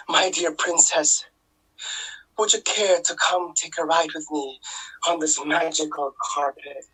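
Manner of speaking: usual speaking voice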